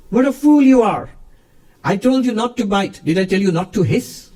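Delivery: a high, loud guru's rebuke, playfully exasperated; mock scolding. Guru scolding his disciple snake, high and loud mock rebuke. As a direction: scold the foolish snake like a fond teacher.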